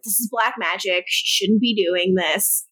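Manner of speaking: normal talking voice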